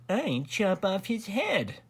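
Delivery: high, slow insinuating voice; whispered scheming. A narrator's reading. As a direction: plant the idea slowly, high-pitched. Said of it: "whispered the Grand Duke Wilfred".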